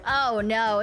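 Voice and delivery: ordinary talk, nothing marked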